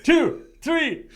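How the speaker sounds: high, loud count; shouted referee count. Pretend. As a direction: count out like a ref. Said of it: "am I the ref".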